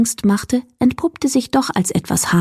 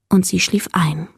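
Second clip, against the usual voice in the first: ordinary delivery, nothing marked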